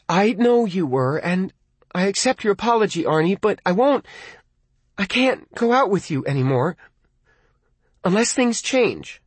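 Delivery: turning him down gently but firmly; Lee's voice. A narrator's reading. Girlfriend refusing boyfriend on the phone, firm.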